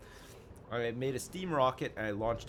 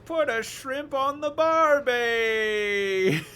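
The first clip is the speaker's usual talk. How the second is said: slow, high, loud mock Australian accent; hammed-up Australian accent; 14 semitones higher, 12 dB louder, much slower than usual. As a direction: belt it out like a cartoon Aussie. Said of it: "do your best Australian accent".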